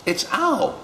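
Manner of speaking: high mouse announcing. Acted. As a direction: chirp it out.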